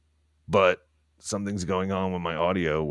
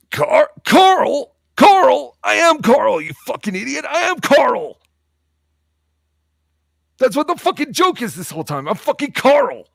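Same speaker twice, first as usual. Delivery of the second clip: much higher, much louder outraged yelling; loud angry impression; 10 dB louder than usual. Yelling at chat that he is Carl, furious high yell. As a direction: shriek it like a furious character insisting he's Carl. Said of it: "I can't do loud impressions right now".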